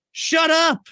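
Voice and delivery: high, loud burst of exasperation; sudden loud, high-pitched outburst; exasperated shout. Real, not acted. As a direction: shout it at the spoilers, fed up. Exasperated rant at spoilers.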